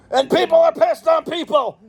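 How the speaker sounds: frustrated shout; angry outburst. Real, not acted. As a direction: blurt it out in exasperation. Repeated outburst about wrestling result.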